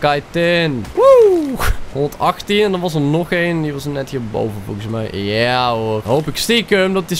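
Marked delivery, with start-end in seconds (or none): 0.9-1.5 a high, loud cheer of joy; excited whoop. Real, not acted. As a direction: whoop like you just won. Gets a kitten, cheers.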